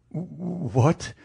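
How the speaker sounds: shocked stammer, catching on the opening sound. A narrator's reading. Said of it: "he stammered".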